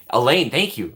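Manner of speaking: ordinary talk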